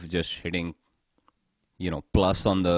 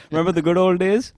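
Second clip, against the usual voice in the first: mock chummy buddy; 5 dB louder than usual. Mock grocery shopper reminiscing.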